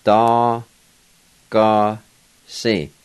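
"da, ga, si" as three separate held syllables; drawn-out breakdown of "da, ga, si"; syllable-by-syllable pronunciation. Shown as a demonstration. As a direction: sound out da, then ga with its vowel, then si. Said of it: "it's pronounced".

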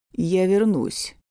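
slow, steady promise; firm and calm. Acted. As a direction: say it firmly and calmly. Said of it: "твёрдо и спокойно говорит он".

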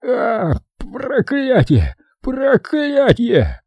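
high, slow, gagging curses; retching curse. Acted. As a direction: groan it out while retching. Vomiting boss curses.

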